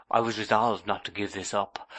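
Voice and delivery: ordinary delivery, nothing marked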